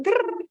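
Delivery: high rolled stuck-motor noise; high "grrr" of being stuck for a word; imitated stall noise. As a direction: imitate a brain jamming mid-sentence. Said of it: "'cause I'm going".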